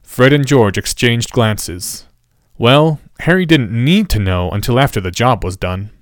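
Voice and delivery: ordinary delivery, nothing marked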